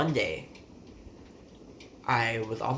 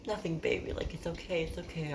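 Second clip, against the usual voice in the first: high, soft imitation of a mother; soothing mom; 7 semitones higher, 4 dB quieter than usual. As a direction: hush a worried child in a high, gentle voice. Mom soothing worried child.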